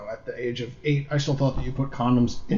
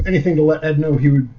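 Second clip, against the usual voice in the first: ordinary talk, nothing marked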